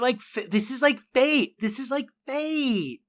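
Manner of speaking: high breathless gush; light starstruck swoon; giddy disbelief. Retold or imitated. Character declaring fate.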